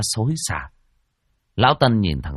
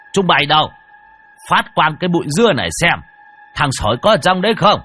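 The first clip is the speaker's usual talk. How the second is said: loud, higher call to the villagers; shouting out; 7 semitones higher, 6 dB louder, faster than usual. Acted. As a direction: bellow the order across the bushes. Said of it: "lão hô to". (hô to gọi mọi người)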